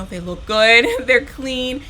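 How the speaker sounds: ordinary delivery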